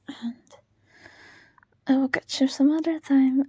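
ordinary talk

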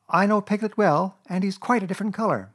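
put-on high, loud child's voice; boy Christopher Robin. A narrator's reading. Christopher Robin judging Piglet, high boyish certainty.

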